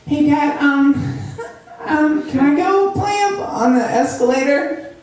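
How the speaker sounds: put-on high, eager child's voice with a giggle; excited little kid. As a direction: beg dad like a seven-year-old. Kid asking dad to play.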